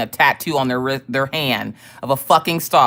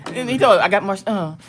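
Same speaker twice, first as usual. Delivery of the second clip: talking through laughter; real laughing; 5 semitones higher than usual. Real, not acted. Wife retelling husband's joke, really laughing. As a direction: crack up while getting the words out.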